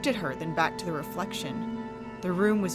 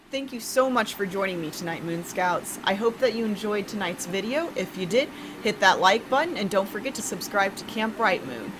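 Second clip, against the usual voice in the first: plain reading voice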